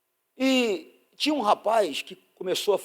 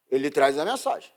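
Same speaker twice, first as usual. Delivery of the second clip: ordinary talk, nothing marked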